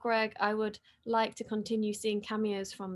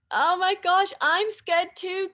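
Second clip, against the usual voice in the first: higher and louder, a giddy burst; nervous excitement; 8 semitones higher, 9 dB louder than usual. Real, not acted. Host reacting to fans as the premiere nears, excited and jittery. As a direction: let the jitters spill out. Premiere about to start, nerves.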